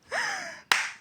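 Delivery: high-pitched gasp; awed gasp. Real, not acted. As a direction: gasp in delight at the prize. Admires her new game horse. (hoquet d'admiration aigu)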